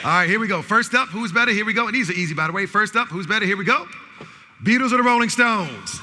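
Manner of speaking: loud, high call-and-response hype; game announcer. Real, not acted. Host calling out music match-ups to the audience, loud announcer hype. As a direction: fire up the crowd to shout back.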